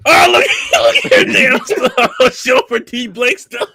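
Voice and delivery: high, loud, slow laughing cry. Real, not acted. Friend laughing loudly at D Blake's stuff. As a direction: shout it out through laughter.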